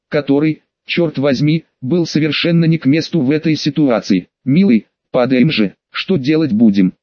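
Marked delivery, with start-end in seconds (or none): none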